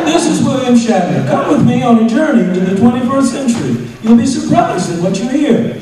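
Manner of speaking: high, loud sing-song narration; mocking the director's read. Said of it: "the guy takes the bait".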